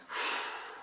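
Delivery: long audible breath out; demonstrated exhale. Shown as a demonstration. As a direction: blow the air out to show exhaling. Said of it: "and exhaling".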